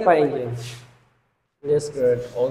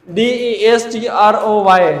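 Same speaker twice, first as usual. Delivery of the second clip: spelling out; slower than usual. Shown as a demonstration. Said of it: "destroy".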